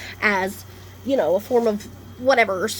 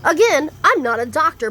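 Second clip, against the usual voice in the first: ordinary talk, nothing marked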